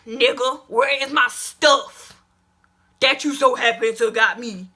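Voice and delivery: loud, slow confronting demand; angry demand. Retold or imitated. Confronting the boy about her things, loud demand. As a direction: demand your stuff back, loud and slow.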